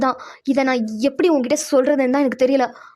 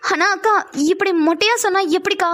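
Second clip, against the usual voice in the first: ordinary talk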